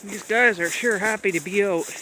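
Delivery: high coaxing dog-talk voice. Real, not acted. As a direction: sweet-talk the dogs along. Talking to the dogs.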